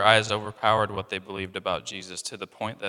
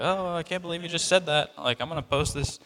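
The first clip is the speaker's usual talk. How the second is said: higher, hammed-up indignation ending in a laugh; mock outrage; 7 semitones higher than usual. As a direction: parody a scandalized social-media poster. Parody of offended onlooker.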